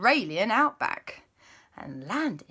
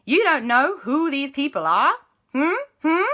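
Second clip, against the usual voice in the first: louder, higher Aussie questioning; Australian guide; 9 semitones higher, 6 dB louder than usual. Acted. Bruce the guide quizzing the parents, Australian accent. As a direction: press them suspiciously. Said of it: "the guide, whose name was Bruce, said".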